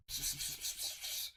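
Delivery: rapid, voiceless "psst" hisses; hushed psst. Retold or imitated. Somebody in the back signalling the clerk, hushed pssts. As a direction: quietly hiss for someone's attention. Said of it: "hears somebody from the back going".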